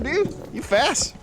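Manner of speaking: usual speaking voice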